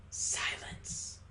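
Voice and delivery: voiceless hushed whisper; soft, slow whisper. A narrator's reading. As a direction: breathe the word out very softly. Narrator drops to a whisper.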